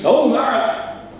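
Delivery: Oh, Mara! called high and slow in Geordie; Geordie greeting. Shown as a demonstration. Quiz host calling out a Geordie greeting to a friend. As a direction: hail a mate with Oh, Mara! like a Geordie. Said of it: "Geordie quiz".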